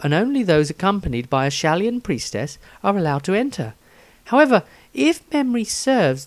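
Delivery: Rysandria diary voice. Acted. S0 reads Rysandria's diary entry.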